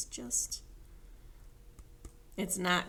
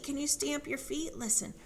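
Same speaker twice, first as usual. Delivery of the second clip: ordinary delivery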